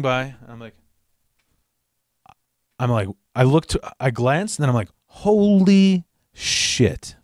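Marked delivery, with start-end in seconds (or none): 5.1-7.2 long, drawn-out stunned exclamation; stunned realization. Retold or imitated. Rider spots his own stolen bike, stunned. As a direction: stretch each word out in reenacted disbelief.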